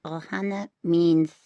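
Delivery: slow, lightly voiced character attempt; Stitch imitation. Shown as a demonstration. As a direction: imitate the character's line slowly. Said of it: "He says, he says".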